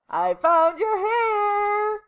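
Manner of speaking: high, loud, drawn-out holler; slow mother's yell. A narrator's reading. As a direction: call out across the house, stretching the words. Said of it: "she yelled".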